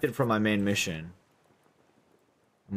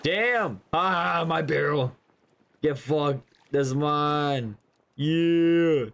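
frustrated outburst; 5 semitones higher, much slower than usual. Real, not acted. Barrel taken, frustrated outburst.

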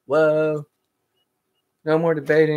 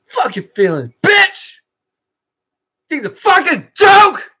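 high, loud furious yell; raging outburst at mom; angry shout; 12 semitones higher, 6 dB louder, slower than usual. Real, not acted. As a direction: lose your temper and scream it out.

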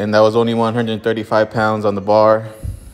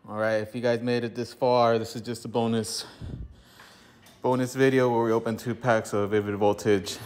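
out of breath; 8 dB quieter than usual. Real, not acted. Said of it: "sorry I'm breathing heavy".

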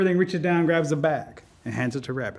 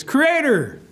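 higher-pitched hail to the Creator; Rabbit calling out; 6 semitones higher than usual. A narrator's reading. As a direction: hail someone from below, pitch lifted. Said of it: "goes back to the creator and says".

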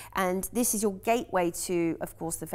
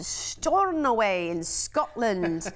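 slow, drawn-out mock Scottish accent; put-on Scots accent, half the usual pace; much slower than usual. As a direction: stretch it out in a playful Scottish accent. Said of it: "have to say it with an accent".

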